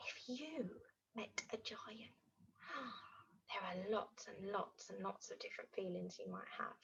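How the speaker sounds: hushed, secretive near-whisper; very soft and full of mystery. Pretend. Hushed wonder to children.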